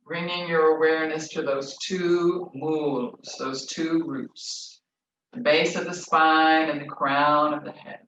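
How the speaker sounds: slow, calm meditation guide voice; slow guided visualization, drawn out. Acted. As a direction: ease them gently into stillness.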